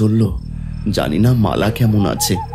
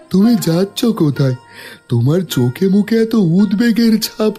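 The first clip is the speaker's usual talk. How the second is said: a bit higher, thankful animal voice; grateful goat voice; 3 semitones higher than usual. Acted. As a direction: thank him like a gentle goat.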